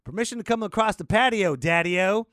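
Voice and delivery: loud high daddy-o hollering at the officer; goofy drunk shout. Retold or imitated. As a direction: belt it out like a drunk hepcat. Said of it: "and I said".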